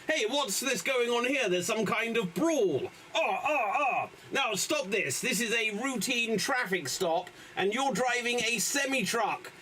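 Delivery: lightly voiced traffic cop. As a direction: play a mildly bossy cop. Improv cop character at stop.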